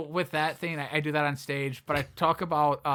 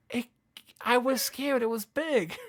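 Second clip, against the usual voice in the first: a hint of a timid, higher girl's voice; lightly voiced girlfriend; 8 semitones higher than usual. Girlfriend explaining to him, lightly voiced timid excuse. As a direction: play her small and sheepish, lightly. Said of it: "And she goes".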